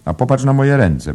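high, loud exclamation; exclaimed complaint. A narrator's reading. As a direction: wail out a complaint to a friend. Waitress complains about her hands.

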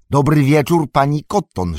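high, loud hello; hearty greeting. A narrator's reading. Sam greets farmer's wife on the steps, heartily.

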